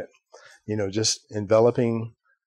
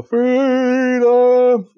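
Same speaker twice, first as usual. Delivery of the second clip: high, loud, drawn-out movie battle cry; Mel Gibson; 14 semitones higher, 7 dB louder than usual. Retold or imitated. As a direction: holler it long, imitating a film clip. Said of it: "background of Mel Gibson show".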